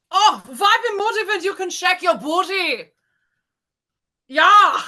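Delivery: high, hammed-up German accent. Shown as a demonstration. Contestant attempting the given phrase, shaky-grasp German accent.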